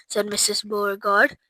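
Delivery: plain reading voice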